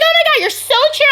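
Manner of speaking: high, fast, gushing imitation; gushing coworkers. Coworkers gushing over her chill attitude, high and fast. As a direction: squeal it like an excited coworker. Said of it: "They're like".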